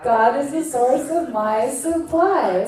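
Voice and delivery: slow, solemn affirmation. Shown as a demonstration. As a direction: let each word land slowly. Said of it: "Say it one more time".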